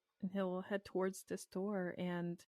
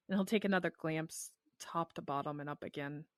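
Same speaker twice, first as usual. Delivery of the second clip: ordinary talk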